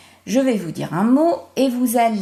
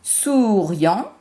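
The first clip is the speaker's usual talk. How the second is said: "Souriant" slower, souuu-riant, with a rising end; stretched syllables. Shown as a demonstration. Teacher isolating riant for pupils, "Souriant" drawn out. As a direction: hold the first syllable of "Souriant", then place riant clearly. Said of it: "La syllabe où j'entends le son I".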